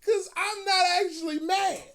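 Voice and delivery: high, loud, laughing-crying delivery; half-sobbing laugh; laughing through words. Real, not acted. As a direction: say it while cracking up. Laughing while explaining.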